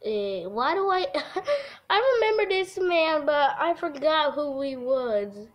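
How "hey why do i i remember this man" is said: ordinary talk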